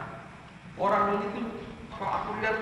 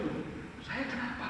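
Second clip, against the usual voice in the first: small, higher, bewildered reply; flustered; 8 semitones higher, 11 dB quieter than usual. Retold or imitated. Startled preacher answers the shouting grandpa, flustered and soft. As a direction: softly act out being caught off guard. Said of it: "gelagapan betul".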